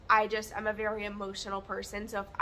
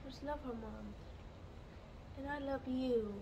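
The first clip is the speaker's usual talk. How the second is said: voicing the pet; 3 semitones higher, 10 dB quieter than usual. Voicing the pet's reply.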